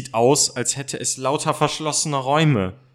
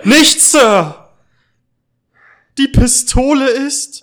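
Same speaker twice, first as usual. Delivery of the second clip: high, loud alarmed plea; frightened plea; 11 semitones higher, 10 dB louder, slower than usual. Acted. Peter pleads with the man about the pistol, frightened cry. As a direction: cry out in fear. Second boy pleads too.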